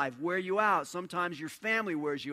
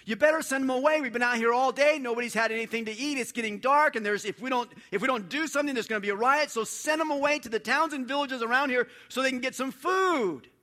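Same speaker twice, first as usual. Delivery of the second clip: loud, higher, panicked plea; frantic disciples; 7 semitones higher, 6 dB louder than usual. Retold or imitated. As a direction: urge Jesus to send the crowd away, in a rush. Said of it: "getting a little bit frantic".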